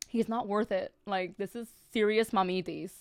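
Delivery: usual speaking voice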